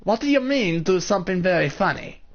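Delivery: high-pitched hammy character voice; bad voice acting. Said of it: "Bad voice acting right there".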